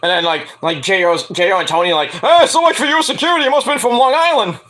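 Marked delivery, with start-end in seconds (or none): 2.2-4.6 mocking jab. Mocking quote of JO.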